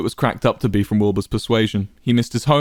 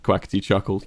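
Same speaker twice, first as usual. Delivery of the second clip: ordinary delivery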